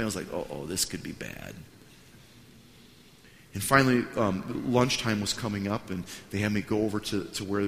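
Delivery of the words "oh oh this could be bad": low, soft uh-oh; sense of dread. Retold or imitated. Pastor foresees trouble with the famous pastor, dreading it. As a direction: sink down into quiet misgiving.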